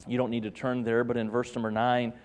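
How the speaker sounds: usual speaking voice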